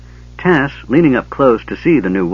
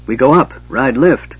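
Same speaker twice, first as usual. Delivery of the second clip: louder, higher panicked yell; yelling gully dwarf; 5 semitones higher, 4 dB louder than usual. Acted. Gully dwarf yelling at Bupu to go back up, rough shout. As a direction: yell back up the pipe at her. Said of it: "yelled one".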